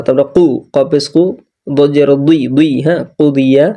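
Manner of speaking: ordinary talk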